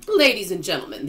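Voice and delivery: loud, composed formal address; controlled dismay. A narrator's reading. Old grasshopper addresses the stranded travellers, controlled dismay. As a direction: LADIES given weight, then settling down. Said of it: "trying very hard to keep the fear and disappointment out of his voice".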